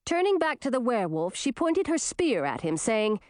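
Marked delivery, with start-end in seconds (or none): none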